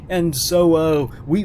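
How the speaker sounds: ordinary delivery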